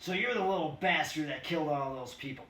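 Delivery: a mob of cops yelling in triumph; gloating cops. Acted. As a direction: shout it down at him, gloating. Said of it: "gloating, righteous exclamations".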